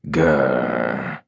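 low mock animal growl; playful growl. Captain growling at his wife in their game, low and mock.

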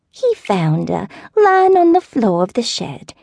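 put-on high, slow, wavering old-lady voice; elderly southern neighbor. A narrator's reading. Neighbor phoning with bad news, slow high old-lady voice.

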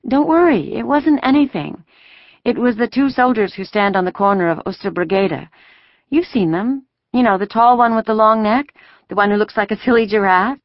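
put-on high-pitched girl making light of it; reassuring young girl. A narrator's reading. Daughter calming two mothers about soldiers, reassuring. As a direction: play it down in a high girlish voice.

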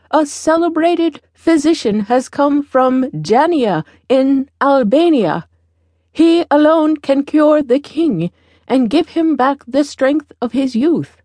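high-pitched public announcement; old woman demonstrating a herald-like cry. A narrator's reading. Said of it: "cry out".

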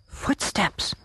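hushed, breath-only whisper; scared whisper. A narrator's reading. Buster hearing a sound outside the tent, whispering. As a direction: whisper it so nobody outside hears. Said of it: "whispered Buster".

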